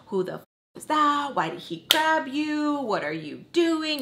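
angry confrontation. Retold or imitated. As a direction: demand answers, stretching the words. Partner angrily confronts her, acted.

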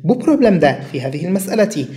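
usual speaking voice

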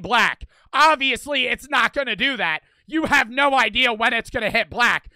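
loud, heated retold rant at a friend; angry rant. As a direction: chew him out, voice pushed and heated. Ranting at friend over roulette bets.

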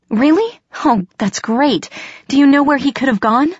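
eager, high-pitched questioning; excited. A narrator's reading. Girl asking the cat where Puck went, excited and hopeful. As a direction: pitch leaping up with hope.

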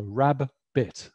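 "rabbit" said slowly as rab, bit; syllable split. Shown as a demonstration. Teacher splitting a word into syllables. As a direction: break "rabbit" into two slow beats. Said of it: "the sounds and the symbols".

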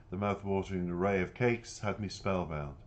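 usual speaking voice

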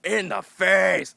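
loud taunt stretched out slowly; retold yelling. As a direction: gloat at the opponent. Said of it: "yelling at".

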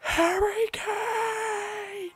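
very high, strained squeal; drawn-out, breaking into high-pitched joy; stifled celebration. Real, not acted. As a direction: scream it in a squeak without waking the house. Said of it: "I have to be quiet so I don't wake my house up".

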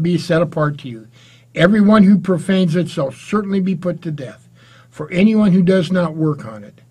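loud, solemn Bible reading; scripture reading. Acted. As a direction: proclaim the verses to the congregation. Preacher reads scripture aloud.

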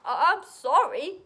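loud, slow, high stammered apology; spluttering dragon. A narrator's reading. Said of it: "he spluttered".

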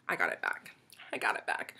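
soft hushed glee; quiet delighted whisper; whispered triumph. Real, not acted. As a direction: whisper it like a secret win.

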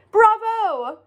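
high, loud crowd cheer; cheering shout. A narrator's reading. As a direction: shout it to the stage. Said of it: "they shouted".

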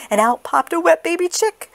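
usual speaking voice

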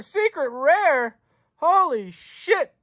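high, slow and drawn out; slow awed excitement. Real, not acted. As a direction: savour each word in awe. Awe at secret rare.

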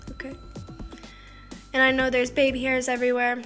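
normal talking voice

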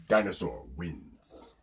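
low, soft declaration; hushed verdict. A narrator's reading. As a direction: drop down low to declare the winner. Narrator declares each round's winner.

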